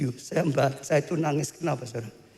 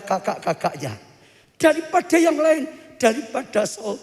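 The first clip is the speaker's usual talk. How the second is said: impassioned preaching; 12 semitones higher, 4 dB louder than usual. Real, not acted. Preacher telling the congregation God loves praise, impassioned. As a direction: preach with soaring fervour, stretching key phrases. Preaches on David at full force.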